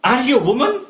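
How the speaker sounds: a wife shaming her husband with her father's words; indignant, biting Sita; angry Sita. Retold or imitated. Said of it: "Sita is angry".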